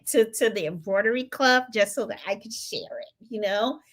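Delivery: ordinary delivery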